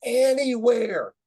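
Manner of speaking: high, drawn-out shout; big gleeful chant-like holler; kids' shout. Retold or imitated. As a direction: yell it out like a roomful of kindergartners. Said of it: "the kids shouted out".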